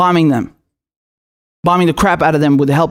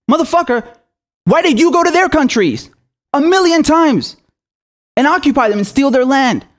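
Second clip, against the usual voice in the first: furious high outburst; 6 semitones higher than usual. Real, not acted. Ranting at Britain over bombing other countries, furious high yell. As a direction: pitch jumps way up, indignant and furious.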